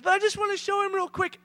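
put-on high, pleading child voice; little kid. Child begging to show dad before he leaves, high and pleading. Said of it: "And Hadley goes".

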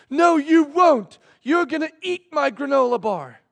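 high, loud mock tantrum; arguing toddler. Three-year-old accusing his father, loud high mock outrage. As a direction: push back like an outraged little kid, stretching words. Acted quarrel, child arguing back.

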